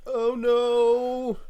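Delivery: high, loud mock panic; panicked cry. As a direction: clutch your head and cry out. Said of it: "put my hands on my head and be like".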